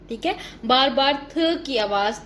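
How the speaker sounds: normal talking voice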